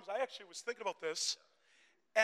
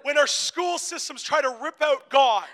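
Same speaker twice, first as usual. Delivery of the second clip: louder preaching, building in force; raised pulpit voice; impassioned preaching; 5 semitones higher, 13 dB louder than usual. Real, not acted. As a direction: preach it out with rising conviction.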